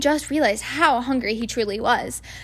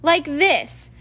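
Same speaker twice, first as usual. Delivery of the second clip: irritable; 5 semitones higher, 5 dB louder than usual. A narrator's reading. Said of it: "he said irritably".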